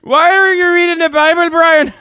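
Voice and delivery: high, loud hammed-up angry dad yelling at Brian; shouting at Brian over reading the Bible; mock dad shout. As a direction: bellow like a furious Irish dad scolding his son. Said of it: "What's up with the dad's accent?".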